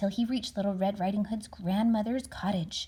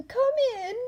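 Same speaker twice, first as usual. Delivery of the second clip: much higher, louder fake granny; wolf as grandma; 6 dB louder than usual. Wolf in bed inviting the girl, high fake granny. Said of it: "said a voice from inside".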